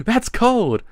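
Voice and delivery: loud, high cry through laughter; real amusement. Real, not acted. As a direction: exclaim while cracking up. Reacts while cracking up.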